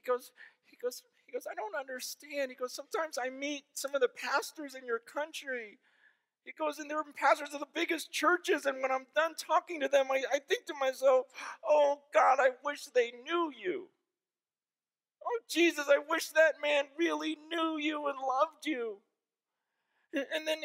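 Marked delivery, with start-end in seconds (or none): 1.5-2.5 high, loud, tearful retelling of his plea; retelling a crying pastor. Retold or imitated. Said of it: "But he's crying on the phone". 2.7-5.8 high, loud, tearful retelling of his plea; retelling a crying pastor. Retold or imitated. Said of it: "But he's crying on the phone". 6.8-19.0 high, loud, tearful lament, slowing on the prayers; retelling a crying prayer. Retold or imitated. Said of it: "He's crying".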